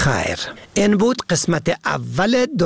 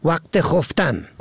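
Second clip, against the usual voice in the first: usual speaking voice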